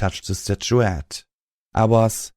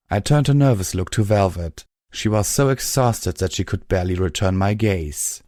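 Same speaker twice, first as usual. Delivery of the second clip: ordinary delivery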